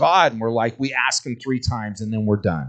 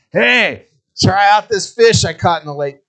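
much higher, loud sales pitch; tricky dad; 8 semitones higher, 9 dB louder than usual. Acted. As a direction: sell the snake as a fish. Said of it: "giving him a snake".

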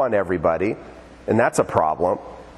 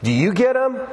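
ordinary talk, nothing marked

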